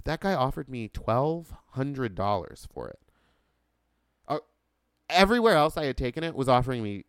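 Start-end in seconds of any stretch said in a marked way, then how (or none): none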